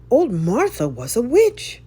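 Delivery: put-on a child spreading the rumour, wide-eyed; excited childish gossip. A narrator's reading. Child telling the others, eager and loud.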